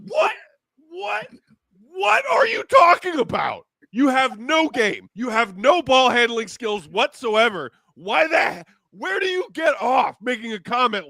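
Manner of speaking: can't believe what he's hearing; incredulous shout. Real, not acted. Host yelling at a player's quote in disbelief.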